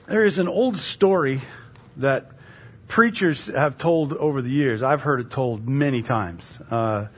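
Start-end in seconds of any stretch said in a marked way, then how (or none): none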